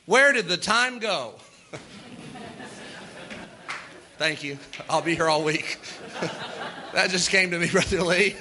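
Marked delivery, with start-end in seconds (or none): 4.2-8.3 laughing. Real, not acted.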